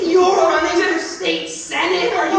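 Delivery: exasperated berating; scornful outrage. Acted. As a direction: hammer out the question in loud disbelief. Berating the would-be senator over car.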